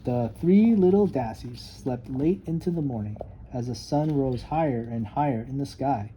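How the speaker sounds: ordinary delivery, nothing marked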